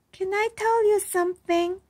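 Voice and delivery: put-on childlike high voice; little girl Iris. A narrator's reading. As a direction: ask it like a shy young girl. Narrator voicing young Iris.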